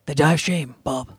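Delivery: a hint of Hopkins murmuring a grim warning; Anthony Hopkins impression. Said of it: "in Sir Anthony Hopkins' voice".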